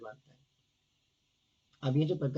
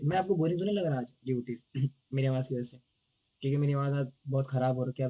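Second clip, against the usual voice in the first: usual speaking voice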